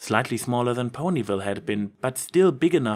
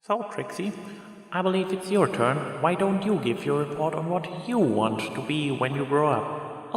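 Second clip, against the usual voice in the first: higher, gentle teacher calling on a pupil; kindly classroom teacher; 4 semitones higher than usual. Acted. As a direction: invite the child to give her report. Schoolteacher in the classroom.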